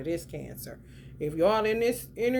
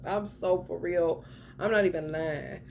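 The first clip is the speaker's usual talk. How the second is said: ordinary talk, nothing marked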